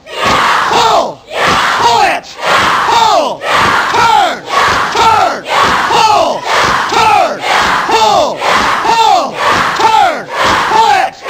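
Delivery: high, full-voiced repeated shouts; shouted drill commands. Real, not acted. Coach driving students through striking drill, high urgent shouts. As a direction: bark each command over the class.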